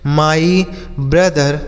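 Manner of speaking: drawn out word by word; slow dictation voice; very slow model phrase. Shown as a demonstration. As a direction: spell it out in sound for kids. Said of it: "teacher's slow model phrase".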